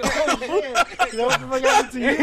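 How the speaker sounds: high, loud, laughing mock shock; laughing disbelief. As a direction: retell your shocked reaction through laughter. Retelling own laughing reaction to friend.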